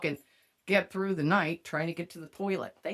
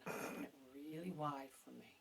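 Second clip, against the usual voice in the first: very soft, lower coaxing; mock seductive hush; 5 semitones lower, 19 dB quieter than usual. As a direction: breathe it out as a teasing lure.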